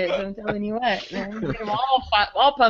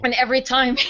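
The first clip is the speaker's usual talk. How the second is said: ordinary talk, nothing marked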